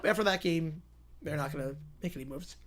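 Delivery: usual speaking voice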